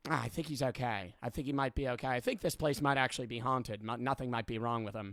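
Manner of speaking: low, soft verdict from the examiner; understated doctor thinking aloud; old-time examiner. Acted. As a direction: mutter a diagnosis, lightly in character. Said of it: "psychological examiner in the year eighteen hundred".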